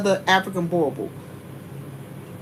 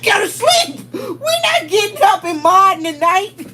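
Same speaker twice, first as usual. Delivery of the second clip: laughing mock shout; 9 semitones higher than usual. Telling the dog to go back to sleep, laughing mock shout. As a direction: shout it through laughter, half-joking. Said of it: "I'm like".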